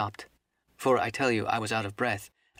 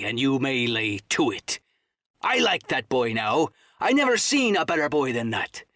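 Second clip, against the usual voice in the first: scornful pirate captain; 3 dB louder than usual. Acted. Silver asserting he is captain here, scornful seaman's voice. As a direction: lay down the law to a cowed crew.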